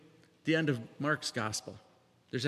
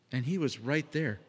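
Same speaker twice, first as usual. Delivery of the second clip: ordinary delivery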